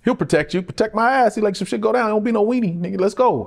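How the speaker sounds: mock homie urging him to ride; tough OG.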